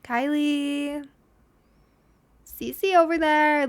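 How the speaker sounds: loud called names; barista calling out. Retold or imitated. Barista yelling names across the shop, loud calling. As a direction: call the names across the café. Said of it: "where they yell out your name".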